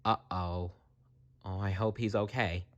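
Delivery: soft, worried aside; hushed worry. Real, not acted. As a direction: murmur it under your breath.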